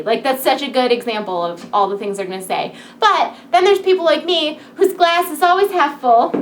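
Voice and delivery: usual speaking voice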